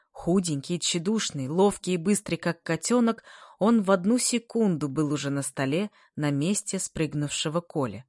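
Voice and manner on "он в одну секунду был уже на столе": plain reading voice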